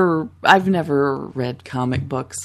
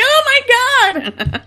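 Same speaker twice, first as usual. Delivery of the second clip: high, loud burst of fangirl glee; starstruck squeal; 6 dB louder than usual. Retold or imitated. Fan retelling meeting Mark Hamill, starstruck squeal. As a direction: squeal it, breaking into laughter.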